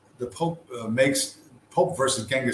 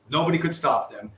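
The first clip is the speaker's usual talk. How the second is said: normal talking voice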